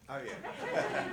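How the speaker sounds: ordinary talk, nothing marked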